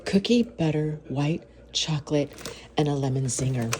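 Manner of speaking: ordinary talk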